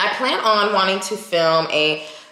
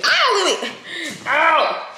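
high startled cry at the dog; startled yelp; 10 semitones higher, slower than usual. Real, not acted. Owner yelping at her dog Lily, startled.

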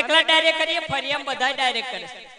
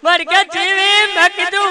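high, loud, slow wailing widow; mock wailing lament; 5 semitones higher, 8 dB louder than usual. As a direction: wail it out like a hammed-up mourner. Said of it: "રડતા રડતા કહેતા હતા".

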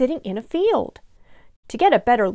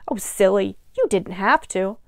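ordinary delivery

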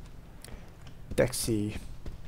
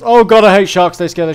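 loud frightened outburst; scared yelp; 6 semitones higher, 12 dB louder than usual. Real, not acted. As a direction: shout it in sudden fright. Panic at a shark in game.

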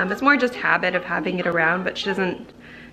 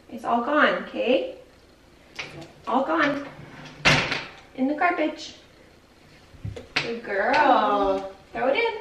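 put-on sing-song baby talk, pitched higher; talking to her toddler; 5 semitones higher than usual. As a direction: coax a little girl gently, like a mom.

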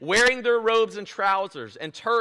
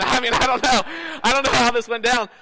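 1 laughing; 5 semitones higher, 7 dB louder than usual. Real, not acted. Laughing at own joke.

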